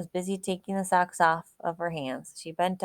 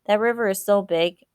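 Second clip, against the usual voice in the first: moaning; 4 semitones higher, 6 dB louder than usual. A narrator's reading. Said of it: "Tony moaned".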